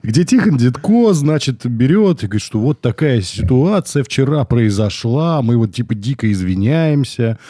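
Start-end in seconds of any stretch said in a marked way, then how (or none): none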